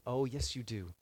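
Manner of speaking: ordinary delivery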